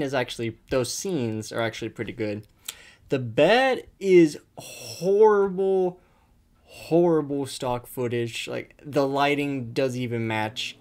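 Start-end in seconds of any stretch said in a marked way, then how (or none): 3.1-7.5 mock-dramatic announcement. Real, not acted. Announcing the bad part.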